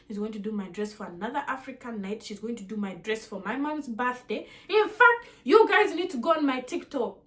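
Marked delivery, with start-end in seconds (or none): 4.7-7.2 much higher, louder, bursting with enthusiasm; excited urging. Real, not acted. Telling viewers to check her TikTok, excited and loud.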